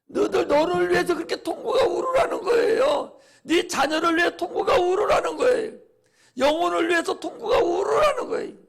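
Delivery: loud, pleading preacher's cry, every line hammered; preaching at full force. Acted. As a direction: urge the congregation to weep for their souls. 설교 절정에서 외침. (통곡하라고 외치는 힘찬 설교)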